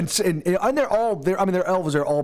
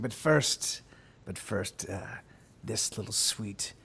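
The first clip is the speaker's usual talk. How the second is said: soft, lecherous villain; slow, hushed leer at a victim; quiet leering menace; 4 semitones lower, 8 dB quieter, slower than usual. Acted. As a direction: sink low and soft, savoring the threat. Lecherous sailor turns on young elf.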